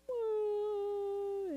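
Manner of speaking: high imitated wail of a small child; mock child wail. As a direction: wail like a little kid who just got told off. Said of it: "and then you hear the crying".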